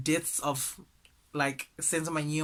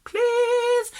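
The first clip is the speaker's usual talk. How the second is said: very high, loud drawn-out plea; hammed-up wailing appeal; mock begging; 7 dB louder than usual. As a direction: beg in a stretched, piercing mock cry. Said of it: "she's just begging people".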